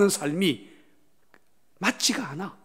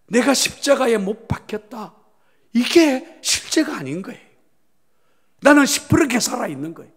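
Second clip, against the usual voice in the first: higher, louder, rushing toward the end; forceful preaching; 6 semitones higher, 5 dB louder than usual. Real, not acted. Pastor presses the congregation, fervent preaching. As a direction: drive the point home, pressing forward.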